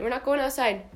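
usual speaking voice

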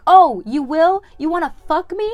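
very loud, high challenge; mock confrontation. TV character finally answering the catcaller back, loud mock challenge. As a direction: call his bluff at full volume.

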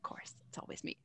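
wry whisper under the breath; whispered aside. Real, not acted. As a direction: mutter it as a joking aside. Mock complaint under the breath.

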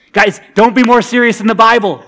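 ordinary delivery

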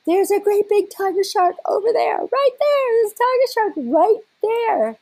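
high, loud reenacted yell to the boat; yelled warning. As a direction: call out the danger across the water. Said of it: "And I just yell".

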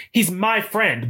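put-on a high, petulant little-girl whine; childlike peevishness. Quoting Jane defending Edwin to Blanche, high childlike pout. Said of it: "this childlike peevishness".

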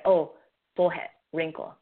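ordinary delivery

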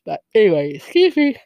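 words stretched through a real yawn; yawning mid-sentence, then apologizing. Real, not acted. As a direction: talk right through a yawn. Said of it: "now I'm yawning".

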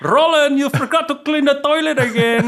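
high-pitched Indian accent impression; imitating the boss. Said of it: "I can still hear his voice".

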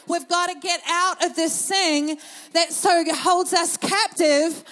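slow, loud, driving sermon build; full-force preaching. Acted. Pastor urging the congregation out of their bubble, preaching at full force. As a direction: preach it out, pressing every phrase. Preacher quoting God's heart.

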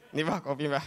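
low, deferential offering voice; placating helper. Acted. Helper hands coffee to the possessed elder, placating.

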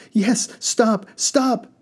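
high, loud pleading; terrified passengers begging. Acted. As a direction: beg him to stop. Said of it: "the passengers begged".

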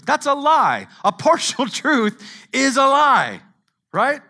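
loud, high, driving preaching; loud sermon climax; full-force preaching. Acted. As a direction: preach it hard to the room.

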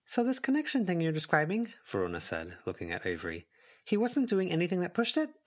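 put-on a curious young woman asking; young woman Verona. A narrator's reading. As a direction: play Verona as curious and bright. Narrator voices Verona.

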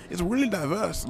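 high-pitched, badly imitated Australian; terrible Australian accent. Man retrying an Australian woman's words, high terrible Australian accent. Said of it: "very, very terrible Australian accents".

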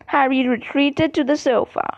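ordinary delivery

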